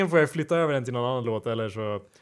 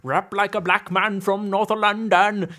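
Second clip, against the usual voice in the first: higher-pitched, drawn-out put-on London accent; odd London accent; 5 semitones higher, slower than usual. Shown as a demonstration. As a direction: slowly lay on a strange London accent. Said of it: "i en konstig accent säger".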